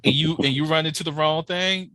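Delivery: ordinary delivery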